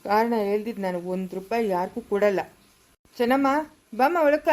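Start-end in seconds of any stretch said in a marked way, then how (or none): 3.2-4.5 calling out. Acted. Man calls Chennamma inside.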